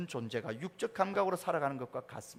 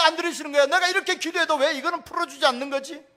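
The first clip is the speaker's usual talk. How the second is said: high, loud, aggrieved questioning; complaining to God; 9 semitones higher, 13 dB louder than usual. Retold or imitated. Believer grumbling to God, high and loud. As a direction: plead and protest like a hurt believer.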